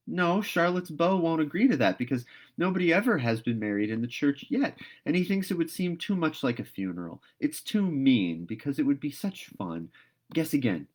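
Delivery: put-on eager girl telling a friend to guess again; lively Diana. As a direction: keep her guessing gleefully. Diana answering, teasing.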